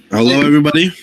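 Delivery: loud, upbeat host hello; stream host greeting. Acted. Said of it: "show intro again".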